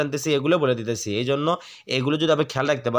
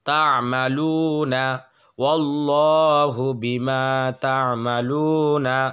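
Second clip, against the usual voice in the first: slow recitation; slower than usual. Shown as a demonstration. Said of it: "এক আলিফ টান".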